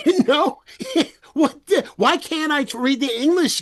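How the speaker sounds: high, loud, laughing protest; real laughter breaking into the words; laughing disbelief. Real, not acted. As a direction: laugh through an incredulous objection.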